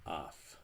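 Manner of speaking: short af ending in a soft f; af, unvoiced f sound at the end; voiceless af. Shown as a demonstration. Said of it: "would make the sound".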